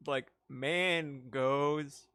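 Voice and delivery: very slow, stretched like mangoes; slow drawn-out repeat. Shown as a demonstration. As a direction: drag out like mangoes slowly, savouring it.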